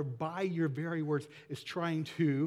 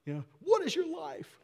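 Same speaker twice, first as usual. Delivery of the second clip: much higher, mock-disbelieving; incredulous James; 13 semitones higher, 4 dB louder than usual. Pastor voices James, amused disbelief at the proud. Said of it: "James' questions in this passage just crack me up".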